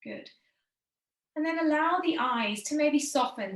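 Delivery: usual speaking voice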